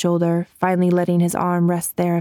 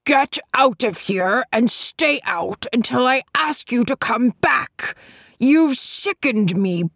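raised, slow, cutting rebuke; angry uncle casting out his scheming nephews; angry old man; 3 semitones higher than usual. A narrator's reading. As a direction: throw them out in disgust.

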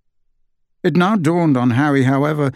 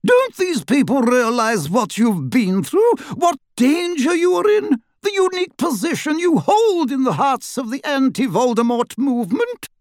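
higher and higher, ominous indignation; rising outrage; 12 semitones higher than usual. A narrator's reading. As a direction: let the outrage climb line by line. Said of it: "her voice rising ominously".

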